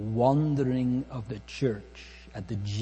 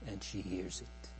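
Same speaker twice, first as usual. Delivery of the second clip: much softer, dropped low in level; soft echo; 3 semitones lower, 12 dB quieter than usual. Real, not acted. Preacher echoing the bride's hearing, softly. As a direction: let the repeat fall away to a hush.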